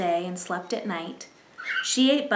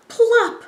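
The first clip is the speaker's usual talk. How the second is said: high, loud comic plop; plop sound effect; 12 semitones higher, 8 dB louder than usual. A narrator's reading. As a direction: pop it like a drop. Grasshopper drops in.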